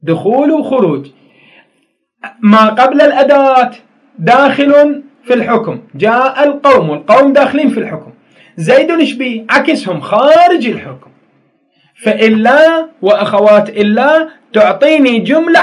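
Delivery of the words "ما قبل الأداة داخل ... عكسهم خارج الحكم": loud, raised explanation of the rule; emphatic teaching. Shown as a demonstration. Teacher explaining to pupils, loud and emphatic. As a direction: drive the rule home to the class. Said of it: "teacher stresses grammar rule".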